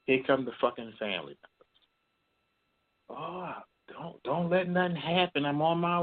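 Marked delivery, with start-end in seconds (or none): 3.1-6.0 high, hammed-up panicky relative; mock frantic relative. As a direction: parody the family rushing in. Mocking frantic family members.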